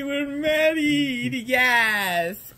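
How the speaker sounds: loud, high, drawn-out celebration; excited cheer. Acted. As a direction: whoop it out, stretching the words high. Cheering a wedding in skit.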